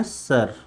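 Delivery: "सर" with the final र sounded; Hindi pronunciation. Shown as a demonstration. Teacher showing the class the Hindi sound, "सर" with r pronounced. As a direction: say "सर" Hindi-style, ending on a clear r. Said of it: "Hindi sound अगर उसको देखें".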